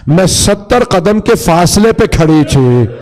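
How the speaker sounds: high, plaintive lament to her grandfather; anguished sister's appeal; grieving Zainab, pleading. Acted. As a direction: plead in mourning, rising to a cry.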